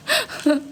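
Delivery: high giggle; laugh. Real, not acted. Streamer repeats the girl accent joke, then a high giggle. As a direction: giggle brightly.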